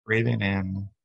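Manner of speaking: calm, even meditation guide with long pauses; unhurried body-scan voice, settling at phrase ends; guided meditation. Acted. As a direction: lead them gently through breath and body.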